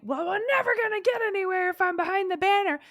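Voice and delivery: high, fast, put-on protesting voice; grumbling critic. Critic objecting to the nanny, high and fast. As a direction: complain like a stubborn inner voice. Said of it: "that one's like".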